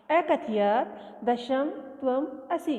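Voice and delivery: अकथयत् दशम त्वम असि slowly, word by word; slow dictation. Shown as a demonstration. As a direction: read it out slowly for the class. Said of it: "ये देखो ये रहा गणयित्वा". (धीरे-धीरे लिखवाना)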